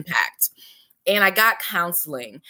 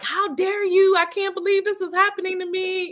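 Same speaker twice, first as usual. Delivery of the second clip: high-pitched outraged protest, acted out; mock indignation; indignant ego rage; 11 semitones higher than usual. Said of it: "my ego was in a rage".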